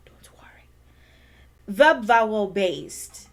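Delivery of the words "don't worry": whispered don't worry. Real, not acted. Teacher whispering a reassurance. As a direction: whisper it to them.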